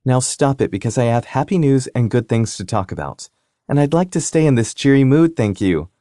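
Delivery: bossy sister. Acted. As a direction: scold them sweetly like a younger sister. Sister scolding cheerfully.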